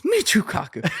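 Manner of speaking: high, loud call of the name; mock shout of the name. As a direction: belt it like the clip. Replaying Weinstein's clip line.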